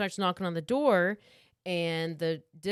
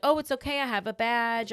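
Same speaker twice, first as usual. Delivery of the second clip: higher-pitched mock reassurance; lightly acted guard, pitched up; insistent security guard; 5 semitones higher, 3 dB louder than usual. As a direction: insist lightly through a closed door. Voices guard pleading through door.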